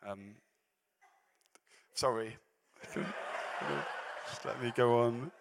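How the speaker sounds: slow words through a laugh; giggling, collecting himself. Real, not acted. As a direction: try to carry on while still laughing. Laughing while regaining composure.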